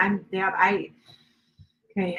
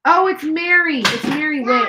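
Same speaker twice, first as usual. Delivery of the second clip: sudden recognition; 9 semitones higher, 8 dB louder than usual. Real, not acted. Suddenly recognizes a viewer's name.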